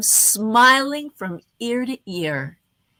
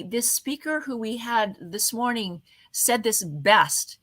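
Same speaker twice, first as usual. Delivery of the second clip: ordinary talk, nothing marked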